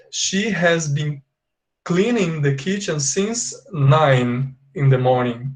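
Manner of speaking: teacher modelling the English sentence very slowly; slow model sentence. Shown as a demonstration. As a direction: read very slowly, as if for dictation. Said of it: "So, in English, I have to say".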